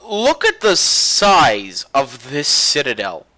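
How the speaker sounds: MASSIVE drawn out slowly for weight; slow, punched delivery stretching SIZE and MASSIVE; emphatic amazement. Real, not acted. Ship reviewer showing off an enormous citadel, amazed.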